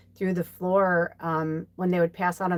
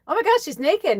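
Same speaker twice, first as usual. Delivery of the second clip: shocked; 10 semitones higher, 5 dB louder than usual. Retold or imitated. Shocked at naked woman in ad.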